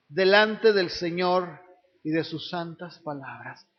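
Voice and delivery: slow, grave reading of the lament; scripture read with mournful weight; solemn scripture reading. Acted. Reads Jeremiah slowly.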